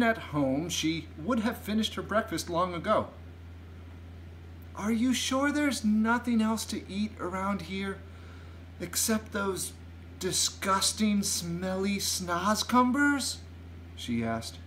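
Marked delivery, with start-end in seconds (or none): 4.7-13.4 Sophie questioning, pitched well up; a child's high, doubtful question; higher girl's voice. A narrator's reading. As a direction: drag out the disgust on the last words. Sophie voiced in story, questioning giant.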